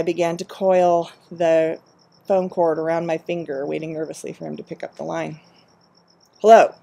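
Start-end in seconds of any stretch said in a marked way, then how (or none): 6.4-6.7 a much louder, gruff, curt hello; curt, angry hello. Acted. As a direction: bark the greeting, irritated and drunk. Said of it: "His voice was gravelly, and it was curt with anger".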